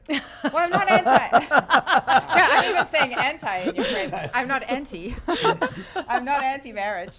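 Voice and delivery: laughing loudly through a playful protest; laughing denial. Real, not acted. Laughing protest about being anti.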